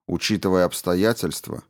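usual speaking voice

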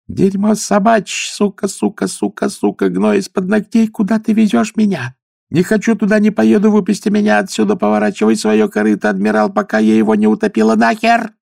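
shrill high hysterical shrieking; screaming. A narrator's reading. Mad woman screaming at the old man on the ship. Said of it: "Последние слова она почти кричала".

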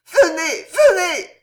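high, loud cry for help; cried out. A narrator's reading. Young woman at her window calling to the chevalier, crying out. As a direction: call out urgently, high and loud. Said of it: "et cria".

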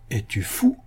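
restrained official rebuke; dignified. A narrator's reading. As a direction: say it with composed dignity. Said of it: "lui dit le chancelier dignement". (ton digne, retenu)

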